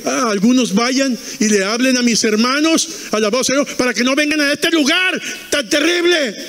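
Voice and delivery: rising, high-pitched imitated pleading; acted desperate plea. Man in hell begs Abraham for his brothers, acted plea.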